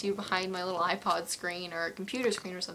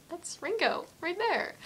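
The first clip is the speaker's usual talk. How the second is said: high, awed recounting; reliving the shock of spotting an idol; retold starstruck disbelief; 10 semitones higher, slower than usual. Fan recalling the first close look at [name], starstruck.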